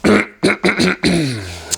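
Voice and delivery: throat clearing. Real, not acted. Cracked voice after excitement.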